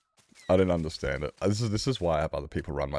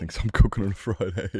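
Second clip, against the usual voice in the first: louder, higher, breaking into laughter; amused repeat; 5 semitones higher, 5 dB louder than usual. Real, not acted. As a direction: say it up high and crack up at the end. Repeats own line, breaks into laughter.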